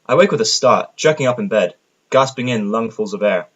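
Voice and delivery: ordinary delivery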